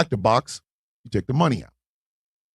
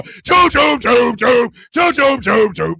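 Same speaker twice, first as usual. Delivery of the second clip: imitated slamming doors; 4 semitones higher, slower than usual. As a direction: mimic doors banging shut in quick bursts. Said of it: "we can hear doors slamming".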